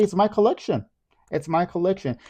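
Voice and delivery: high, insistent and proud; defiant. Retold or imitated. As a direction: stand your ground playfully. Defiant retort to critics.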